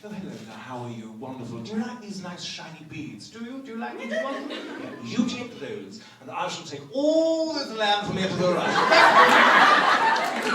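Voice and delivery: genteel British colonist; lightly mocked, courteous British accent, rising to a grand claim. British colonist trades shiny beads for land, polite British accent. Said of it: "only say things like this in this accent".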